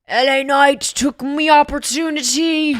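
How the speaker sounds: loud, high, drawn-out Scottish protest; Scottish wrestler impression. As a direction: bellow it as an aggrieved Scottish wrestler. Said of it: "Some Scottish Big Lebowski Walter impression".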